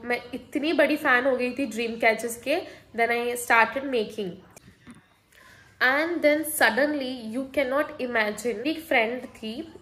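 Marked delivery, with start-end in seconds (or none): none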